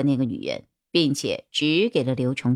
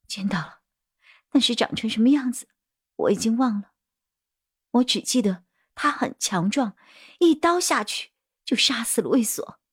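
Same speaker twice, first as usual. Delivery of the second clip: halting, partly whispered, rising higher; frightened woman witness; 6 semitones higher than usual. Acted. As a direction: relive the murder in a fearful half-whisper. (惊恐的女证人，时而气声时而高音)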